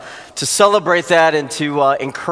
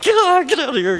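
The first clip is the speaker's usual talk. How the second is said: mock eager rush; 10 semitones higher, faster than usual. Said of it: "you're like".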